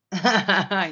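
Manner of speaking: a burst of loud real laughter. Real, not acted. Laughs at her own joke.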